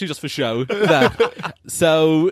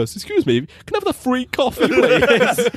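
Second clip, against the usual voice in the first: mock polite request; 9 semitones higher than usual. Imagined awkward request at the counter.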